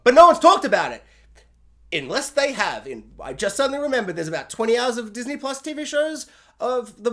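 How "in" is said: usual speaking voice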